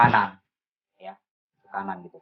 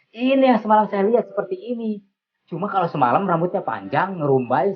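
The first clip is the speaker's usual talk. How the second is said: excited recognition; 9 semitones higher, 3 dB louder than usual. Retold or imitated. Recognising the picture, amazed.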